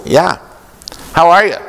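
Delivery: light up for the caller; cheery phone greeting. Retold or imitated. Colleague on the phone with a player, with a cheery, high greeting.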